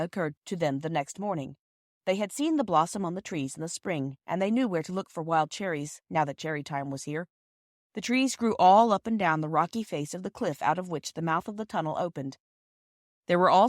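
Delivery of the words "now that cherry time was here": plain reading voice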